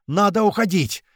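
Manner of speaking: urgent high-pitched cry, modest volume; sudden cry. A narrator's reading. Полукет warns the squad to leave, sudden cry. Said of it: "внезапно закричал Полукет".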